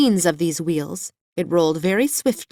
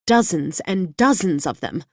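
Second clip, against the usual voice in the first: ordinary delivery, nothing marked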